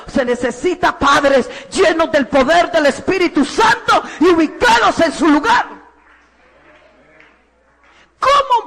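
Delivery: loud, ringing preacher's voice with rising pitch; fervent preaching. Acted. Preacher exhorting parents in church, loud and fervent. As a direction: preach it out to the whole congregation.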